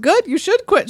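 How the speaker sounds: high, unsympathetic comeback; exasperated retort. Acted. Rose answering the quit threat, exasperated. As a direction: call the drama queen's bluff. Rose's retort in acted quarrel.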